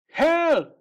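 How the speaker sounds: high, loud cry for help. Acted. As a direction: yell for help while rolling away. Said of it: "he cried".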